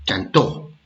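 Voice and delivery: "Cantó" with the accent on TÓ; stress on last syllable. Shown as a demonstration. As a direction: say "Cantó" leaning on the final TÓ. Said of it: "se diferencian por la tilde".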